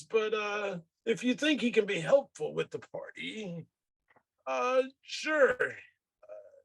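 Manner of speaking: high, halting, puzzled character voice; puzzled high-voiced party member; Karn in character. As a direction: pitch it well up and weigh each phrase.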